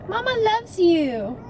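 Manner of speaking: high, slow cooing. Real, not acted. Owner comforting Penny in the car, high loving coo.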